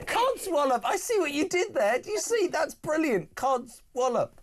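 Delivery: high, gleeful repeating of Codswallop; delighted. Real, not acted. Host thrilled by the guest's word, gleeful and high. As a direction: savour the word with glee.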